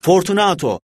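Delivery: high, loud call of "Fortunato!". A narrator's reading. Montrezor shouting Fortunato's name into the niche, loud. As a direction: call his name into the wall. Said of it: "Bir daha bağırdım".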